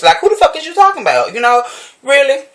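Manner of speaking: who are you even talking about; incredulous outburst. Real, not acted.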